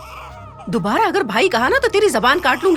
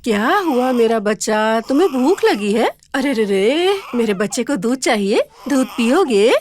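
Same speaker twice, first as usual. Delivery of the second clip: put-on slow, tender coo to a baby; slower than usual. Mother comforting her own crying baby, slow tender cooing.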